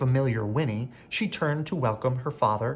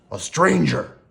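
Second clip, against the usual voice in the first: growled; 4 semitones higher, 5 dB louder than usual. A narrator's reading. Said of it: "growled a savage voice".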